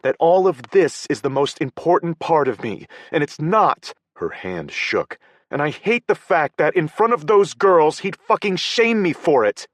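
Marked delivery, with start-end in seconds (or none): 0.0-3.9 higher female voice, strained and upset; Paige upset. A narrator's reading. 5.5-9.7 higher, louder, bitter and hurt; bitter outburst. A narrator's reading.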